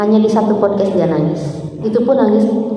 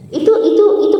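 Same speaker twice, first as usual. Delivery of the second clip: laughing; 9 semitones higher, much faster than usual. Real, not acted. Laughs at the retold scene.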